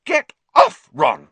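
very high, loud snap; furious girl shaking off her friend; angry shout. A narrator's reading. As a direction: shove him off with your voice. Hermione shaking Ron off.

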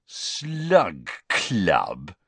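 drawn-out, mocking sneer. A narrator's reading. Boy mocking the club's name to his friend, sneering. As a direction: stretch each word with scornful disbelief. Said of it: "with a sneer worthy of Malfoy".

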